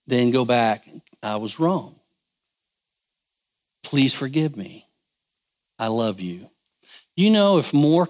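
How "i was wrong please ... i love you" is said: tender apology. Shown as a demonstration. Preacher models apology to spouse.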